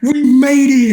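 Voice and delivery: very high, very loud cheer; victory shout. Retold or imitated. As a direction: shout it out in triumph. Shouting victory after surfacing.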